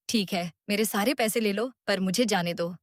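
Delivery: ordinary delivery, nothing marked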